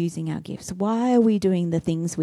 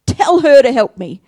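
higher, louder pointed complaint; louder tattling demand; accusing Martha; 8 semitones higher, 7 dB louder than usual. Acted. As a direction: jab a finger at her sister.

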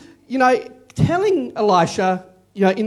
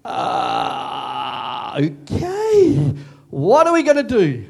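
stunned dismay; 7 semitones lower, much slower than usual. Pretend. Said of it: "you go".

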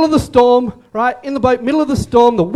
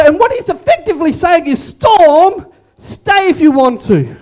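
high, emphatic sermon climax; impassioned preaching; 7 semitones higher than usual. Real, not acted. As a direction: drive the point home to the congregation. Preaches at full force.

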